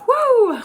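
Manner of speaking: loud high exhale to reset; a real woo shaking off the crying; tearful woo, loud and high; shaky high woo to shake off tears. Real, not acted. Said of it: "I'm gonna pull myself together".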